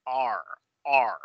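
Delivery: "R" as English ar; curled-tongue sound. Shown as a demonstration. Teacher confirming the sound, curled-tongue ar. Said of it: "ese es eso".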